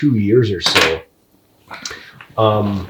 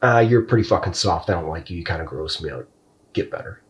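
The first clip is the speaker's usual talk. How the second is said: dropping to a disgusted murmur, trailing into a whisper; muttered self-disgust, ending whispered; quiet self-contempt; 5 semitones lower, 7 dB quieter than usual. Retold or imitated. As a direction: scold yourself under your breath, fading. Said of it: "there's many a day where I'm like".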